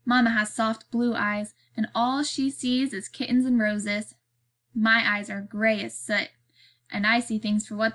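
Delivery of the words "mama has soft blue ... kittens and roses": ordinary delivery, nothing marked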